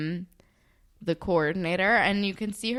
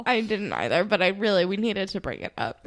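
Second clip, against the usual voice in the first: ordinary talk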